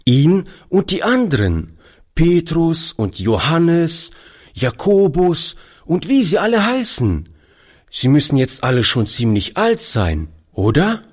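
put-on wondering little boy asking about the disciples; young boy Toni. A narrator's reading. Narrator voices young Toni.

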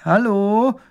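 loud, high hailing call; high, ringing "hello". A narrator's reading. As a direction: call out to someone far away. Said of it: "ruft Emil".

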